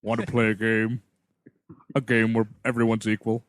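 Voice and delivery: lightly voiced villain, pitched low; low, measured mock-sinister invitation; Jigsaw. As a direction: drop low like a movie villain. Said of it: "Jigsaw is definitely gonna desubscribe".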